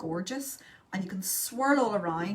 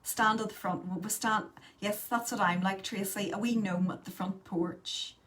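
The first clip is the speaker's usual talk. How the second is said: ordinary talk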